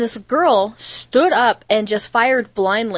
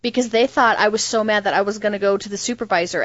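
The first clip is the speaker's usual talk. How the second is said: ordinary talk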